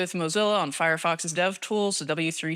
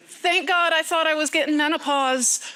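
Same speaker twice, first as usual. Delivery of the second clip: relieved exclamation; 8 semitones higher, 4 dB louder than usual. Real, not acted. Joking relief about the heat.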